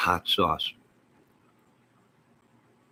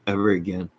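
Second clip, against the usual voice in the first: usual speaking voice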